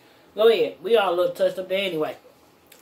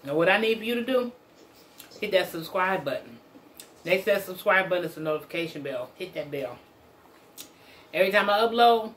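usual speaking voice

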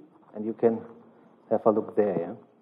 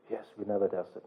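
much softer and lower, owning up; sheepish admission; 5 semitones lower, 10 dB quieter than usual. Retold or imitated. Network team admitting to the customer they never tested, quiet and sheepish. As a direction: concede it quietly, a little embarrassed. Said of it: "feedback from customer".

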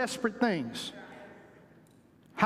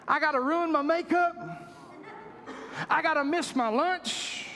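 exasperated, pitched up, protesting; frustrated; 4 semitones higher than usual. Retold or imitated. Leper protesting to the prophet, frustrated. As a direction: whine like a man asked too much. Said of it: "the guy got frustrated".

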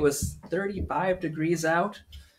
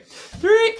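playful count; 6 dB louder than usual. Acted. Playful count, child throws gum.

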